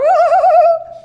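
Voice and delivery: high loud mock laugh; playful laugh. Dad jumping behind the pole, playful laugh. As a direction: laugh to tease the kids.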